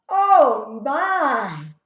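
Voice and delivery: loud, high "Oh my" in amazement; loud together. Shown as a demonstration. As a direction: call it out loud with the class. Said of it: "Can we all say it together loud".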